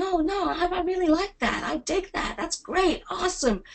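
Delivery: high, gushing American enthusiasm; mock American gushing. Imitating Americans to a webinar audience, gushing. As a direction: play the overly positive American. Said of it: "do it in American accent".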